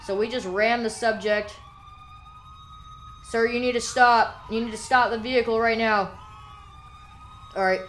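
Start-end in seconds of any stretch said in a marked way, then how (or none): 3.2-6.1 command shout. Pretend. Cop orders a fleeing driver.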